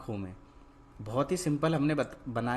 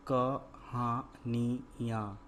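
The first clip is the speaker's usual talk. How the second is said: कहानियां very slow, in separate syllables; कहानियां drawn out, every syllable held apart; 3 dB quieter, much slower than usual. Shown as a demonstration. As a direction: sound out कहानियां piece by piece. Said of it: "तो क्या शब्द बना".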